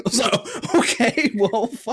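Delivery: high-pitched laugh through the words; breaking into laughter. Real, not acted. Laughs at the absurd mix-up.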